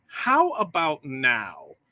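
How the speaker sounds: slow drawn-out mic check. Real, not acted. Host testing his mic for viewers, slowly repeating the question. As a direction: stretch every word of "How about now?" out slowly. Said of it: "mic check".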